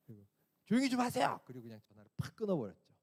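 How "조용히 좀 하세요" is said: curt retold order. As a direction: bark it once before hanging up. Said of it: "쏘아붙였어요". (전화를 끊기 직전 짜증 섞인 명령)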